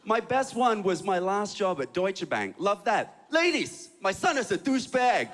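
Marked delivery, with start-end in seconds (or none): 3.3-5.3 put-on high, proud announcement in a Chinese accent; bragging Chinese mom. Chinese mom bragging to her friends about his job, high Chinese accent. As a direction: crow about your son in a Chinese mother's accent. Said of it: "my mom would tell all her friends".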